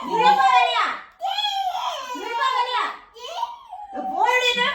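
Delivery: high, loud yelling broken by laughs; laughing shout. Acted. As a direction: yell while laughter breaks through. Grandma's mock fight, half laughing.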